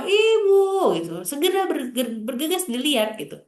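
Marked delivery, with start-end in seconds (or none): 0.0-1.0 high, loud call of Ibu; child yelling for mom. Retold or imitated. Child calls mother from another room, loud high yell. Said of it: "anaknya udah teriak di rumah".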